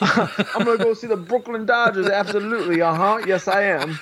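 hammed-up British accent, pitched well up, laughing through it. Host parodying a British cop, in a high, laughing British accent. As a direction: overdo the Brit cop, giggling. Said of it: "purely British".

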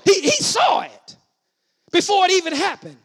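loud, slow, high proclamation; ringing, stretched pulpit cadence; full-force preaching. Acted. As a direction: lift the congregation with each phrase.

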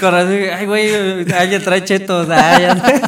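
high, loud giddy chatter; laughing excitedly. Real, not acted. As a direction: talk through the giggles. (hablando entre risas emocionado)

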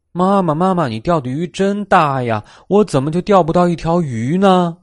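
high, excited kitten voice; childlike storybook cat, high and eager; little cat to mother. A narrator's reading. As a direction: whine in wonder to mum, pitched high. Kitten marvels and complains to mother.

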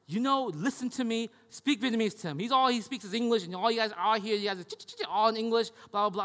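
a lightly voiced nagging grandmother; imitating grandma.

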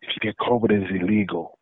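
soft secretive warning; low evasive murmur. Retold or imitated. Dealer warning in hushed tone.

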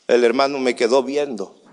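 usual speaking voice

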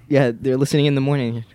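normal talking voice

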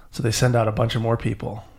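ordinary delivery, nothing marked

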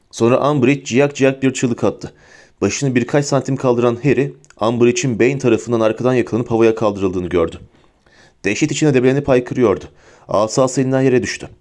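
usual speaking voice

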